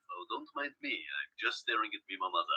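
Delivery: very soft, dropping low; mock muttered aside. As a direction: mumble it under your breath as a pretend aside. Mock aside while staring off.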